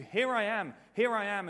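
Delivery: high, loud insistent call. Acted. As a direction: call out to someone who keeps ignoring you. Acting Jesus calling out repeatedly.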